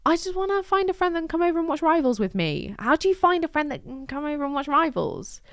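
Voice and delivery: high-pitched, exasperated pleading; plaintive plea. Real, not acted. Lonely newcomer wanting a friend, high plaintive plea. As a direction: wail it high, half in comic despair.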